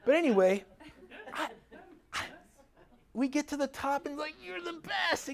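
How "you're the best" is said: praising the dog. Retold or imitated.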